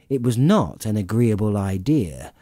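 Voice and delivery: usual speaking voice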